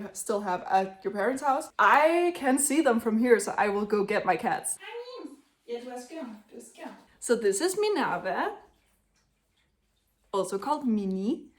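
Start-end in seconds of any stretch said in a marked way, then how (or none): 4.8-5.4 cooing to the cat. Real, not acted. Greeting her cat Mim in high soft baby talk. 5.7-7.0 murmured gently to a pet; soft talk to the cat. Real, not acted. Holding her cat and murmuring softly to it.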